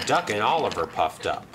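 ordinary delivery, nothing marked

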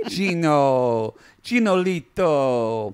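drawn-out, slow mock coo of his name; mock pet-name calling. Girlfriend calling Gino by pet name, mock cooing.

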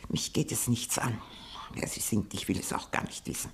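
hushed, dismissive whisper; old lady whispering that she does not care who he is. Acted. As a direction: brush it off under your breath.

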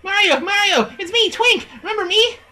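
high, eager little star voice; cheerful Twink voice. Acted. Reading Twink's lines to Mario, high and eager. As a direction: squeak it out like an excited sidekick.

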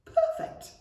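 very high, breathy delight; delighted squeak. A narrator's reading. Goblin delighted with new tooth, squeaky. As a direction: squeak it to yourself, pleased. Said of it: "he thought".

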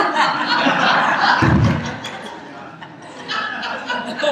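long high laugh at his own joke; real laugh. Real, not acted. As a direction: laugh it out freely.

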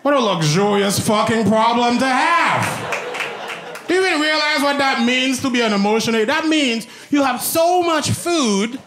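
high-pitched incredulous rant; exasperated, hammed-up shouting; comic outrage. Comic outrage at emotional eating.

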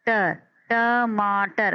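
टर then टमाटर said very slowly; slow classroom sounding; syllables then whole word. Shown as a demonstration. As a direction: stretch out टमाटर after its last syllable. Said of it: "break होगा".

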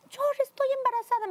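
high-pitched, stunned news-breaking; startled announcement. Retold or imitated. Wife telling husband she is pregnant, high startled blurt. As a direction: blurt it out high, in retold shock.

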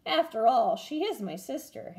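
usual speaking voice

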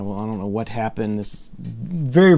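ordinary delivery

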